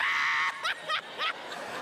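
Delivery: very high shrieking laugh; breathless high-pitched cackle; cop dying laughing. Retold or imitated. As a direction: mimic helpless squealing laughter. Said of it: "the cop is in the middle of the freeway dying".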